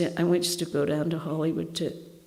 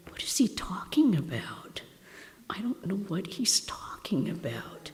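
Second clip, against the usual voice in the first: whispered, baffled inner thought; a bewildered young nun whispering to herself; confused whisper; 6 dB quieter than usual. Retold or imitated. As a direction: murmur it under your breath, puzzled. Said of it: "I'm sitting there going".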